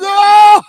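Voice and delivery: high, loud wail breaking off; mock howl of pain. Caned schoolboy at stroke ten, mock howl of pain. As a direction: let out a hammed-up cry. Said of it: "he said".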